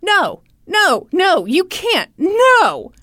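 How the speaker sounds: high, loud, acted-out objection; firm protest. Father stopping the manager taking son's phone, angry. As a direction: imitate a father loudly forbidding it.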